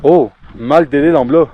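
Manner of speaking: loud, slow, drawn-out exclamation; drawn-out exclaim. Real, not acted. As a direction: stretch it out and call it loudly. Calls out the Nepali phrase.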